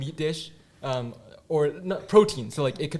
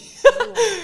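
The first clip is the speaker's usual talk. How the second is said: high, loud burst of laughter; 12 dB louder than usual. Real, not acted. Laughs at own poor smell.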